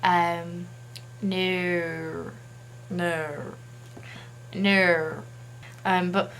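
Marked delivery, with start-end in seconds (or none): 1.2-5.2 drawn-out Australian "no", practised aloud; amateur Australian vowel on "no"; attempted Australian accent. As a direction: try "no" with stretched Australian vowels. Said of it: "my Australian accent is not good".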